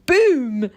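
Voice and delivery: loud boom; imitated boom. A narrator's reading. Goat mimics the thunder, loud boom. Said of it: "said Goat".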